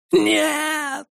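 high, drawn-out distant-sounding scream; wailing. A narrator's reading. Twin about to be dropped, wailing. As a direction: scream it long but held back in volume. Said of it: "завопил Диего".

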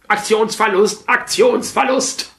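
high, slow robot alarm call; Roboter-Alarm. Retold or imitated. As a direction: drawl it out like a malfunctioning robot. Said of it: "Alarm ... Aktionsverlust (Clip)". (hohe, langsame Roboter-Alarmstimme)

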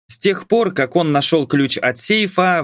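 plain reading voice

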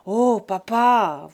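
slow, breathy sneering "Oh, papa"; mock sneer. Son trying to sneer at his raging father, breathy mock laugh. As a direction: try to laugh it off. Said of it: "voulut ricaner Buteau".

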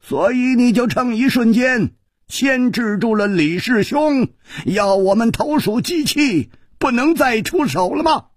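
high-pitched elder demanding an answer; high, insistent elder; old man's challenge. A narrator's reading. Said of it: "那硕长老人说".

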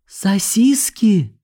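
put-on high, slow, awed child; little boy voice. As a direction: breathe it like a hungry kid. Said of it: "тяжело сглотнул ... мальчуган".

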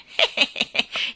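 gleeful wheezy cackle; old man's cackle. Acted. Great-grandfather gloating over Jane, mocking cackle. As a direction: cackle in a run of short hehs.

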